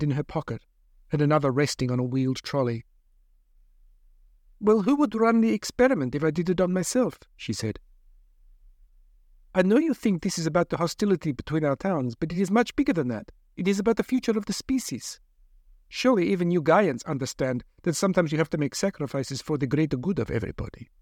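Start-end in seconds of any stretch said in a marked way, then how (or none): 4.6-7.2 a lightly voiced, higher doctor, reasoning; hint of a persuasive lab doctor. Narrator voicing the doctor. 9.5-20.9 a lightly voiced, higher doctor, reasoning; hint of a persuasive lab doctor. Doctor lectures caged captive.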